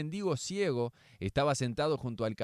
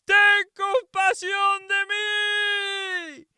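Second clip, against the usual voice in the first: very high, very loud, slow desperate cry; shouting even louder; 10 dB louder, much slower than usual. Acted. Blind beggar crying to Jesus over the crowd, very loud and high. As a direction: scream it out, louder than the crowd. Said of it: "gritó aún más fuerte".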